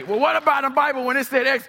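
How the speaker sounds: quick, high-pitched mock challenge; pestering objector. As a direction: imitate someone butting in with objections. Voices an arguer's objections.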